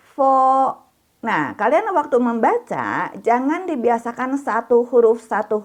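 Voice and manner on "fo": fo held high and level. Shown as a demonstration. Teacher modeling first-tone fo for class. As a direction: keep fo up high and flat.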